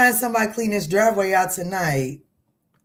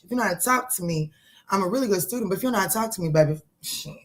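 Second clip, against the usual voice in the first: ordinary talk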